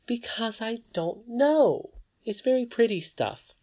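put-on high, slowed child voice, puzzled; a little girl admiring something; young Irene. A narrator's reading. Irene admiring the spinning, high puzzled child voice.

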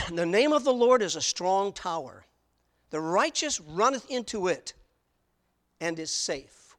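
solemn Bible reading; scripture reading. Acted. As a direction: read the verse out to the congregation. Preacher reads scripture aloud.

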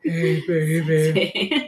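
low, slow and husky; sexy low voice. Real, not acted. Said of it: "You got the sexy voice going on".

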